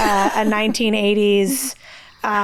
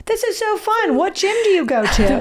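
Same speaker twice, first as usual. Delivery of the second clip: high, gushing pretend small talk; exaggerated chirpy friendliness; mock cheery; 9 semitones higher than usual. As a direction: play up a bubbly, casual ask. Pretend cheery chat with athletes.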